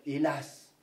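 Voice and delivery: ordinary delivery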